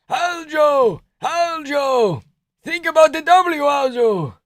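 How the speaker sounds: put-on high shouting corner coach with an accent; hyped high-pitched Georgian corner shouts; Merab impression. Merab yelling advice from corner.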